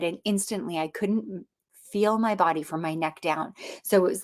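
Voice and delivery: ordinary talk, nothing marked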